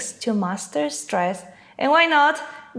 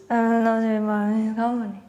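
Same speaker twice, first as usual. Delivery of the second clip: slow mumbled word; much slower than usual. Acted. Interviewer mumbling to a job candidate. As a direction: drag out a mumbled company.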